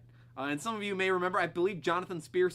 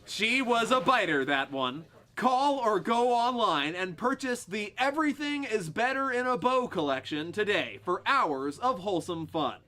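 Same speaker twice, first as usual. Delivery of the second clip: smooth TV pitchman read; spokesperson voice; 6 semitones higher, 5 dB louder than usual. Acted. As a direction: sell it like a TV spokesman.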